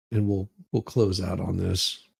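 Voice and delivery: usual speaking voice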